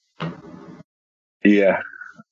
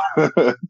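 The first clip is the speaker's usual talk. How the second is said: brief embarrassed chuckle; a nervous little laugh; 6 semitones higher than usual. Real, not acted. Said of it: "I'll be nervous laugh again".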